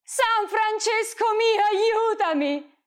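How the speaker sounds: anguished prayer called aloud; desperate cry. Acted. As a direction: cry out for help, slowly. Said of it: "Ed ella gridò".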